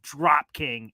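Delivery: ordinary talk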